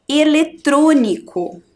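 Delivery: "Eletrônico" slow, with TRÔ stretched and strong; stress on TRÔ. Shown as a demonstration. Teacher marking the tonic syllable, TRÔ stressed in "Eletrônico". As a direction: dictate "Eletrônico" slowly, leaning on TRÔ. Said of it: "Você vai pintar a sílaba tro".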